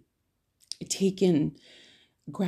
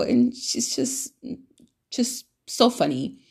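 ordinary delivery

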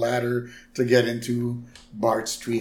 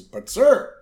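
1 Smithers protest; 8 semitones higher, 6 dB louder than usual. Retold or imitated.

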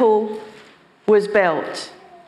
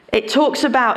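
normal talking voice

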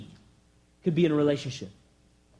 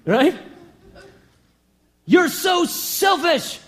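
hammed-up scolding, higher and louder; mock yelling at a rose; 7 semitones higher, 3 dB louder, slower than usual. Preacher mocks yelling at a stubborn rose, hammed-up scolding. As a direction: berate the rose with a laugh. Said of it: "yell at a rose to open up".

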